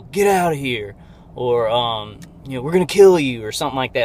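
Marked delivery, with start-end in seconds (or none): 0.0-0.9 mild mock yell, slightly raised; retold yell. The voice she hears ordering her out, mild retold yell. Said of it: "the guy will, like, yell". 2.6-3.5 imitated shout, louder and higher; retold loud threat. Retelling the guy testing the level, loud imitated threat. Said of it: "He'll say it real loud".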